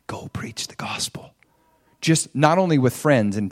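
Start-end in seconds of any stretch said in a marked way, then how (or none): 0.1-1.3 soft unvoiced whisper of God's call. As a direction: breathe the command like God speaking in the heart. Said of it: "and he says".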